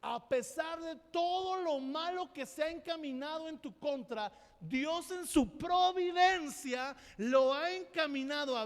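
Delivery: loud, high, drawn-out pulpit preaching; fervent preaching. Acted. Pastor to congregation on affliction, fervent preaching. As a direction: preach it out with full force.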